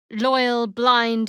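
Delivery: normal talking voice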